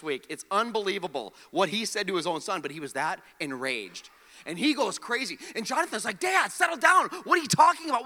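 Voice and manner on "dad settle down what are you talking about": high, loud pleading with an angry father; alarmed plea. Retold or imitated. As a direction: try to calm a raging dad. Jonathan confronting enraged Saul.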